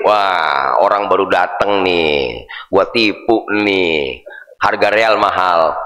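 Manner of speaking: low, slow sly muttering trader; sly inner voice plotting to cheat; scheming trader aside. Acted. (pedagang licik bergumam pelan dan rendah)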